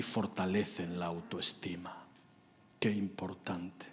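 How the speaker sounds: slow hushed whisper; quiet emphatic hush. Real, not acted. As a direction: drop to a whisper to stress it.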